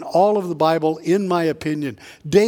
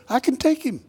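put-on higher-pitched cocky young volunteer; eager self-assurance; 6 semitones higher than usual. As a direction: pipe up, sure you can win. Voices David's boast to Saul.

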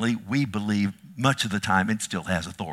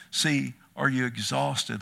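ordinary talk

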